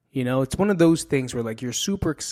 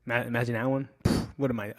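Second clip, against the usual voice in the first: ordinary talk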